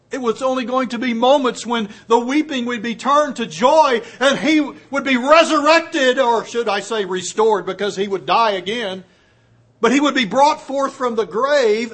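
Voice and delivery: exultant sermon build; fervent preaching. Real, not acted. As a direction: proclaim the resurrection with fire.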